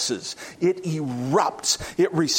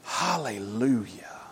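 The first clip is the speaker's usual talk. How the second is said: reverent hallelujah; 7 semitones lower, 5 dB quieter than usual. Real, not acted. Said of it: "What a rich word that is".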